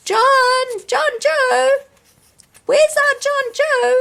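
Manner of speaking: very high, loud calling; a brother shouting for his lost little brother, high and loud. A narrator's reading. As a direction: call out across the fields. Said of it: "shouted Sammy".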